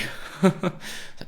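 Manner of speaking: airy high-pitched laugh; high breathy laugh. Real, not acted. Laughing at his own jab at leftists, high airy laugh. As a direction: burst out laughing.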